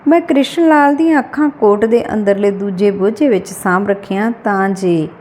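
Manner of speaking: usual speaking voice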